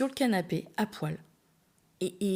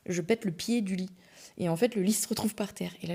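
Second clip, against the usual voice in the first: ordinary delivery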